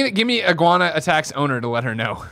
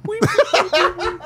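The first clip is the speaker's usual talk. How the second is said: high laughing guinea pig squeaks; much slower than usual. Retold or imitated. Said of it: "that's the guinea pig noise they make".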